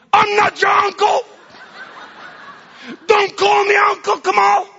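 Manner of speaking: very high, full-voiced shout; furious protest. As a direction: yell it to push them away. Acted rejection of children's welcome.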